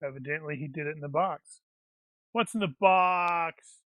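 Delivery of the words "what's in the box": drawn-out, loud cry; mock anguished shout. Player riffing on the box, mock anguished shout. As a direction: stretch the words into a mock shout.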